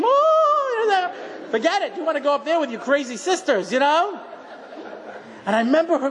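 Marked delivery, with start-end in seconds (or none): 0.0-1.1 very high mock yelp of a scared father; high mock scream. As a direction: squeal up high like a scared dad. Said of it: "listening to him to scream".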